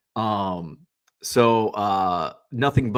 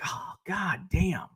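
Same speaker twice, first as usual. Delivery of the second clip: slow, higher, softer muttered curse; a hint of the exasperated mom; 7 semitones higher, 7 dB quieter, slower than usual. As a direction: lightly voice the fed-up mom. Voicing the angry mom.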